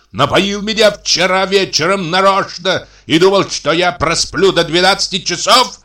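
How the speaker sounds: high, heated complaint; aggrieved ranting. Acted. Left-behind brother scolding his brother, aggrieved ranting.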